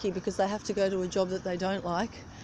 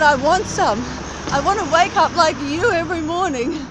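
eager stranger; 6 semitones higher, 6 dB louder than usual. Retold or imitated. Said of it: "He said".

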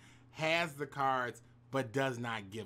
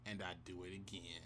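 deadpan punchline; 8 semitones lower, 13 dB quieter than usual. Acted.

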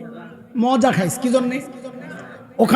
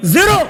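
Zero yelled much higher and louder; forceful shout; 8 semitones higher, 5 dB louder than usual. Shown as a demonstration. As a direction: throw zero out with full force. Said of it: "আবার ঠান্ডা হয়ে গেছে". (Zero জোরে চিৎকার করে বলা)

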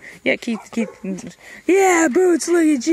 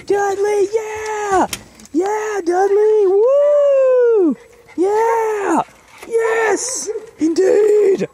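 excited cheering; 6 semitones higher, much slower than usual. Real, not acted. Cheering the dog.

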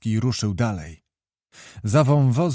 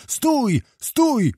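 sharp, much higher shout to halt riders; shouted command; 12 semitones higher than usual. A narrator's reading. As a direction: bark stop at the dark figures. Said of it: "począł wołać Tyzenhaus".

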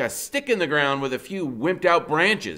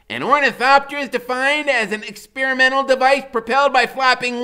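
put-on high, loud, fast know-it-all kid reciting a definition; small genius boy lecturing the big neighbor, high and loud; dictionary talk; 10 semitones higher, 8 dB louder than usual. A narrator's reading. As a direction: rattle it off like a tiny professor. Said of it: "That's how he talked, like right out of a dictionary".

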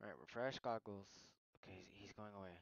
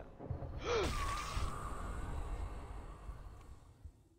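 loud gasp; 8 dB louder than usual. Real, not acted. Dramatic gasp.

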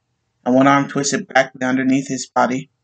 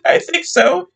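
high, tearful sob; sobbing; slower than usual. Acted. Wife telling police husband is dead, high sobbing. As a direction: choke out the words in tears. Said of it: "she sobbed".

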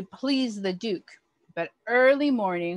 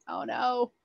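ordinary delivery

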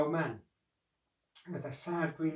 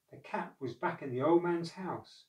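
ordinary delivery

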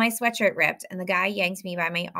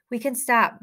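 higher, louder call ahead; yelled from afar; 6 semitones higher, 6 dB louder than usual. A narrator's reading. Miles up ahead to running friends, yelling. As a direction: call it out to friends behind you. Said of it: "Miles yelled from further up".

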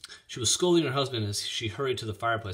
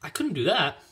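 put-on higher, louder girlish refusal; dismayed girl's objection; alarmed cry; 7 semitones higher, 5 dB louder than usual. A narrator's reading. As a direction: protest it in dismay, pitched up. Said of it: "cried Ellen".

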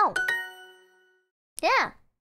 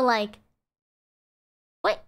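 ordinary delivery

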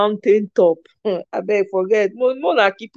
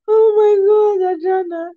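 helpless laughter; 13 semitones higher than usual. Real, not acted. Cracking up at a comment.

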